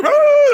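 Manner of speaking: hammed-up high shriek, very loud; over-the-top exorcist shriek; mock shriek. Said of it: "shriek and yell".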